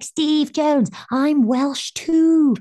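high, loud and very slow starstruck cry; excited fan greeting. Retold or imitated. Starstruck teen hugging her Welsh idol, excited. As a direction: stretch it out, thrilled to meet him. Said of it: "I threw my arms around him".

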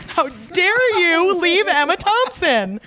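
high, drawn-out indignant scolding; mock outrage. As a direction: scold in hammed-up outrage, stretching the words out high. Mock scolding of Branagh over Emma Thompson.

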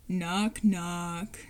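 low, drawn-out gloomy knock-knock; deadpan it, weighed down with gloom; solemn, depressed. Acted. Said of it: "solemn and depressed".

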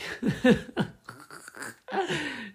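high, loud burst of laughter. Real, not acted. Owning up to failing the bac, laughing.

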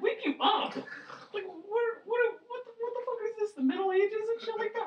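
very high, squeaky mock disbelief; mock incredulous. Storyteller scoffing at his girlfriend's suggestion, mock incredulous. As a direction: shoot way up high in comic outrage.

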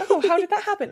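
high, loud incredulous question; acted astonishment. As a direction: ask it as if amazed. Acts out surprised question to friend.

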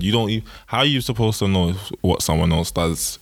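ordinary talk

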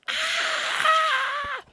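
very high shouted scream; imitated loud scream. Imitating her cousin screaming at the top of her lungs, a high shout. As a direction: let out a sharp high shout. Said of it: "but, like, way loud".

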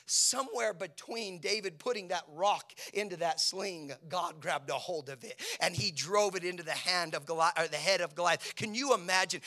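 loud, high pulpit shout; sustained high shouting, easing at the very end; full-force preaching. Real, not acted. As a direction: preach it as a battle cry.

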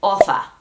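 "oza" with a th sound, OH-tha; Castilian Spanish z. Shown as a demonstration. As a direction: lisp the z of "oza" as in Spain. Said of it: "osa como española".

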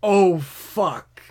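loud, high mock dismay; president's dismayed reaction. President getting bad news, loud mock dismay. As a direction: let the curse burst out. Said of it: "him getting the call".

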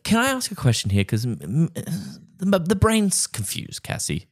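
high-pitched, puzzled questioning; confused questioning. Real, not acted. Host asks co-hosts about the dollies, high-pitched and confused. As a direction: ask it high, baffled and hesitant.

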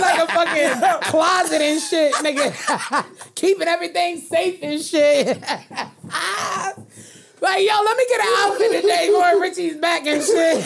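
talking through laughter; cracking up mid-sentence; laughing. Real, not acted.

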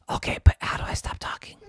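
hoarse whisper with no voice; lost voice. Acted. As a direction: try to talk but only air comes out. Jerry voiceless throughout.

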